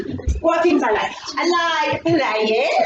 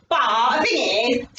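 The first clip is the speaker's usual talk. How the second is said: ordinary talk, nothing marked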